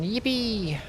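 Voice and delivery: high, soft cheer; yippee cheer. Real, not acted. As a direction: celebrate with a high little yippee. Celebrating another game reward.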